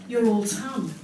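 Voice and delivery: ordinary talk, nothing marked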